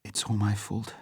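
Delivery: a hushed guilty confession on the edge of tears. A narrator's reading. Vesper blaming herself to Bond, tearful and soft. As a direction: say it quietly, holding back tears. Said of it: "Suddenly her eyes filled with tears".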